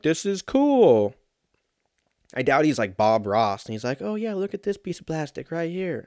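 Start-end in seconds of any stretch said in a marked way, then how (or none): 0.0-1.1 put-on high, drawn-out kid voice, louder; mock kid's voice. Host mocking a kid who unboxes toys, mock kid voice. 4.0-6.0 high-pitched, put-on celebrity voice; Mike Tyson. Host imagining a kid toy reviewer, mock boxer voice. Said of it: "he sounds like Mike Tyson".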